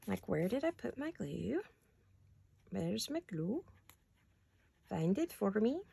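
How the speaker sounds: a weak, soft-spoken mock Swedish accent; half-hearted Swedish accent while hunting for glue; quiet attempt at a Swedish accent. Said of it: "So much for my Swedish accent".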